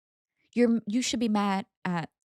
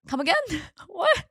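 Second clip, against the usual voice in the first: incredulous; 8 semitones higher than usual. Retold or imitated. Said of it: "you would genuinely just be like".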